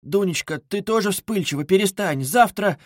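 high-pitched, flustered pleading; scared. A narrator's reading. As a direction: plead anxiously to calm her daughter. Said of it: "перепугалась мать".

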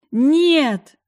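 loud desperate scream. Acted. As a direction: scream to stop him. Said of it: "заорала я".

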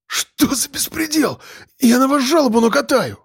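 high, strained outraged cry; outraged protest. A narrator's reading. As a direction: choke out the outrage after a jab. Suspect outraged after elbow. (возмущённо, сдавленно и высоко)

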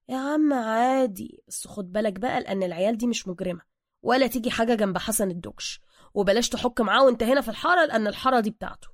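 plain reading voice

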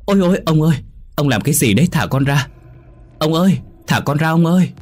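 high-pitched, alarmed pleading; frightened cry. A narrator's reading. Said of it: "miệng hét lên". (kêu lên hoảng sợ, giọng cao)

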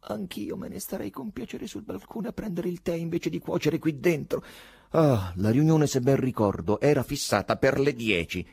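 high-pitched, outspoken complaint; Zagribov, bold. A narrator's reading. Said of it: "disse arditamente Zagribov".

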